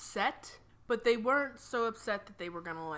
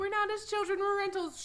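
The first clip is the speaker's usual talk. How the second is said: mock kid voice; 12 semitones higher than usual. Kid voice for rented child.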